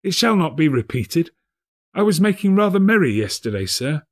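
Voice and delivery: high, begging Bob; pleading clerk. A narrator's reading. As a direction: plead with the boss.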